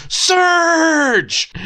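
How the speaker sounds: high, loud yell of a name; mock-heroic high-pitched shout; hammed-up shout. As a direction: belt out SURGE like a hype slogan. Mock-yells soda-like character name.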